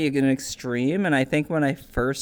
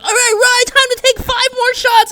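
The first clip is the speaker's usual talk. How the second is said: very high, louder mocking voice; pushy party host; 8 dB louder than usual. Instance owner pushing the sick friend to drink, in a mocking high voice. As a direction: goad him into drinking in a silly high voice. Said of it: "he's like".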